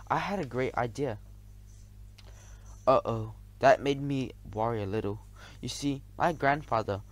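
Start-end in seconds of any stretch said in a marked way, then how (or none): none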